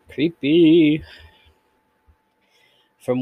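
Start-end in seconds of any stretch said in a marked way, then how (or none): 0.1-1.0 drawn-out spooky creepy. Real, not acted. Reacting to the story.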